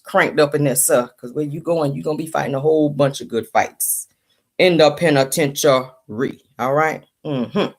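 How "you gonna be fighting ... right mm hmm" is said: ordinary talk, nothing marked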